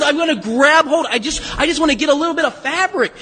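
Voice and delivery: eager crowd member clutching for a piece; grabby, pawing. Acted. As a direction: act out someone pushing in to grab. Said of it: "this is pawing".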